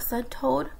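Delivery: ordinary delivery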